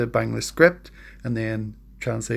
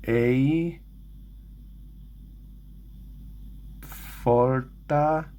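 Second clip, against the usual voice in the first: halting learner's reading, long gaps between words; sounding out; 6 dB quieter, much slower than usual. Real, not acted. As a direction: work out each syllable slowly and tentatively. Learner sounding out Bengali script.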